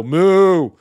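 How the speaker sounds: loud, high mooing cow. Retold or imitated. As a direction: blurt a cow's moo to cut in. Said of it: "Interrupting cow".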